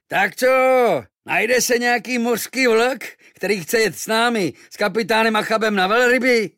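high, loud call to the sailors; calling out to crowd. Acted. The boy calls to the sailors, high and loud. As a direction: hail the whole harbour.

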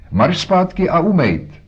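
high, loud scolding shout; shrill-free, full-volume motherly yell. Acted. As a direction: yell at the boy to get back inside. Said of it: "Žaku křičela".

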